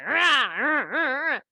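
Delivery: loud, high rah rah of a quarrel; loud mock squabble noises between spouses; mock arguing noise. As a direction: bark out the bickering sounds. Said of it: "My husband and I were like".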